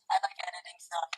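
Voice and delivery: like a better tin can. Real, not acted. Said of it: "Now it sounds like you're talking into a better can".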